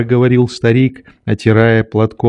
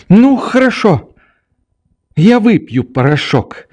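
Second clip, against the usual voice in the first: put-on high child's voice, mildly exclaimed; sudden little cry; 10 semitones higher than usual. A narrator's reading. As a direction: pipe up suddenly as little Nelly. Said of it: "вскрикнула вдруг своим слабым голоском Нелли".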